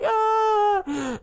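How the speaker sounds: high, drawn-out mock cry of pain; hammed-up childish wail; milk a small injury for all it's worth; overdramatic wail. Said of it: "I made it such a big deal".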